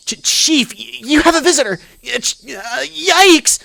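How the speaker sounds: high, loud stammering alarm; frantic high-pitched warning; panicked employee. Acted. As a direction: yelp it out in a squeaky panic, tripping over the words. Panicked employee interrupts the chief.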